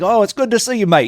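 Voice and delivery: high, hammed-up Australian greeting; a cheery Aussie mate impression; Australian accent. Imitating the Australian greeter at the door. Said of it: "you know, that accent".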